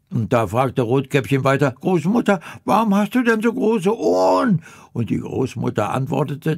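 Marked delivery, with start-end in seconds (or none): 1.7-4.6 put-on much higher, quick childlike question; little girl voice. Rotkäppchen asks the disguised grandmother, high and childlike. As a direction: ask like a wide-eyed little girl.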